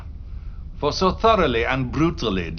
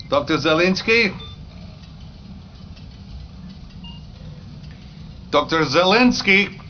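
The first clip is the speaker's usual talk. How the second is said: calling for someone out of sight, raised; 8 semitones higher than usual. Acted. Premier calling for the missing doctor, raised calling voice.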